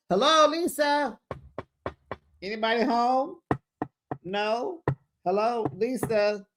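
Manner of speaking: high, sing-out check for life; high-pitched playful hello to a mannequin; mock calling out. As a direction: call teasingly to someone who's spaced out. Mock calling to unresponsive Lisa.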